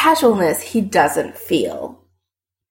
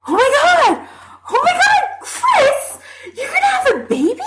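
much higher, laughing, thrilled shriek; excited squeal. A narrator's reading. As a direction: squeal with delight and laugh through it. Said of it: "as she squeals again".

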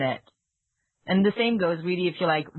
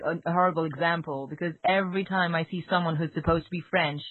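usual speaking voice

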